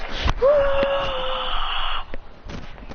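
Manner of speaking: long, high, contained scream; high strained yell; acted punch scream. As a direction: sustain a battle cry through the punch.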